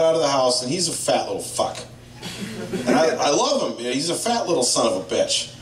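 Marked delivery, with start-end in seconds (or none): none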